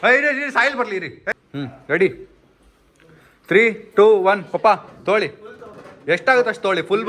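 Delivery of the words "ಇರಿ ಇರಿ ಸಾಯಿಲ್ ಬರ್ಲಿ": ordinary talk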